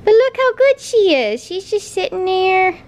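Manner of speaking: high, sing-song puppy talk; dog-talk voice. Real, not acted. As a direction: coo at the dog excitedly. Praising dog.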